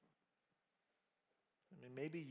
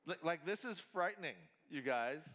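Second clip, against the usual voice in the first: ordinary delivery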